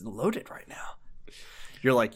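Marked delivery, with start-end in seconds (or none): 0.0-0.9 soft breathy whisper. Acted. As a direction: say it under your breath. Hushed aside to partner.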